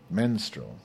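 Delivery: ordinary delivery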